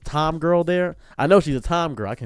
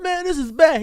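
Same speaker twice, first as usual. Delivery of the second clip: crying; 13 semitones higher than usual. Retold or imitated. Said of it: "He starts crying on me".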